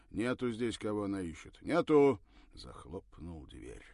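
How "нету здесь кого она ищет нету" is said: loud, curt refusal, final shout; angry. Acted. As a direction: slam the answer shut. Man shuts door angrily.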